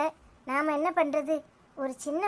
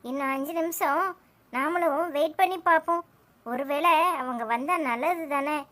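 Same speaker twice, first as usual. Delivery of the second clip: ordinary talk, nothing marked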